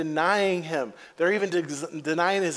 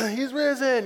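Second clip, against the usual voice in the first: triumphant; 6 semitones higher than usual. Pretend. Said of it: "You're, like, into it".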